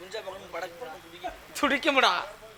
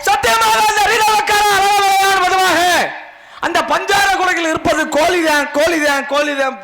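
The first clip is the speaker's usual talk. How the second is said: high, slow declamatory chant; ringing stage vow; theatrical oath; 8 semitones higher, 7 dB louder, slower than usual. Acted. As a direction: swear it to the heavens, drawing the words out. Minister swears a formal oath.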